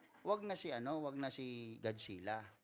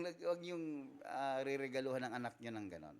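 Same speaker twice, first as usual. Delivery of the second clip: usual speaking voice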